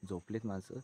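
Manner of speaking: hushed, so the sleepers don't wake; speaking softly. Real, not acted. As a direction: keep it down, people asleep. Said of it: "हळू बोलतो".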